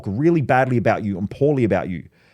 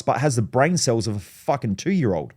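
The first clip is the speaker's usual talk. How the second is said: ordinary talk, nothing marked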